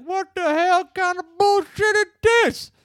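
high, loud, slow angry yell in a crazy voice; mock yell. Imitating Skank yelling about being left behind. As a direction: bellow it like a furious crook.

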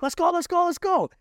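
high, loud getaway shout; re-enacted frantic high shouting; urgent yelling. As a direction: yell at the driver to take off now. Yelling to flee on the scooter.

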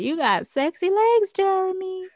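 high, teasing character voice; Sad Eye Joe. Retold or imitated. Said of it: "then Sad Eye Joe's like".

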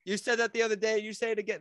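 loud, high, furious outburst; angry outburst. Retold or imitated. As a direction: blow up at the insult. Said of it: "he just goes off".

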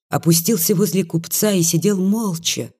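plain reading voice

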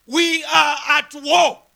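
slow, emphatic declaration; clear proclamation. Acted. America declares war on Japan, slow clear proclamation. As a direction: proclaim each word slowly and firmly. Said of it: "it basically clearly proclaimed and said".

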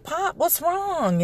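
high, soft and slow; tender concern. Retold or imitated. Mom to crying son in the garage, soft worried. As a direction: ask gently, like he might break.

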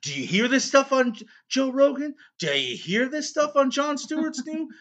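high-pitched mock-outraged questioning; mock indignation. Host defending his burping to cohost, mock indignant. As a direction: pitch it way up in comic protest.

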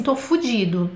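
ordinary talk

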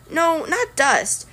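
ordinary delivery, nothing marked

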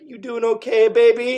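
high, loud sweet-talk to a hurt castmate; tender check-in. Retold or imitated. Actress checking on injured co-star, high and loud. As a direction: coo it up like asking a hurt friend. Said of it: "And I was like".

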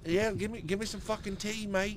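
very high, hammed-up British accent; mock British accent. Demanding tea from a mate, mock British accent. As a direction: squeak it out as a cartoon Brit. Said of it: "I can't do a British accent".

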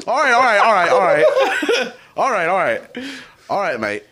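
loud, repetitive warm-up in mock British; bad English accent. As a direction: psych yourself into a hammed-up English accent. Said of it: "it's so bad".

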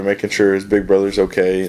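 ordinary talk, nothing marked

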